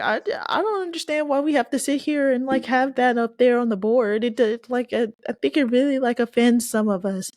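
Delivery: high whiny imitation of a student. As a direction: whine it up high like an offended student. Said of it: "And she was like".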